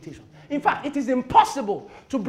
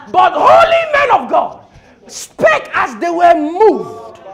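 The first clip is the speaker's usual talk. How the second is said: loud cry with long drawn-out words; full-force proclamation; 6 semitones higher, 13 dB louder, much slower than usual. Acted. Preacher declaring how scripture was spoken, full-force proclamation.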